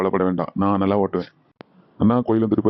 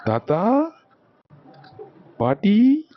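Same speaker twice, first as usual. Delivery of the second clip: high, rising arrival call; rising, eager call into the house; 11 semitones higher, much slower than usual. Acted. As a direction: sing out to the grandparents as you arrive. Grandchild arrives calling grandparents.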